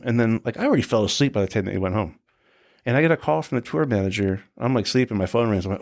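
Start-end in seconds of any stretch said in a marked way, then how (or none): none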